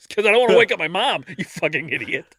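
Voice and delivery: loud, high, fast mock outburst; exasperated retort. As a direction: blurt it out fed up, imitating an argument. Exasperated repeat in retold quarrel.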